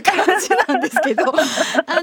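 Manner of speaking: words pushed out through giggles; after laughing hard. Real, not acted. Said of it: "ミキサー笑いすぎて". (笑いをこらえきれずに)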